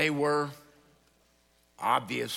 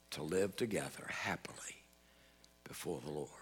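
whispered, softer ending to the story; hushed close; 4 semitones lower, 6 dB quieter than usual. Real, not acted. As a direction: drop to a whisper to end the story. Sermon story's quiet close.

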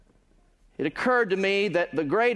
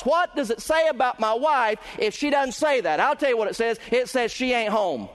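raised, ringing pulpit voice building in intensity; preaching at full force; 8 semitones higher than usual. Acted. Preacher to his congregation, full-force preaching. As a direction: drive the point home to the congregation.

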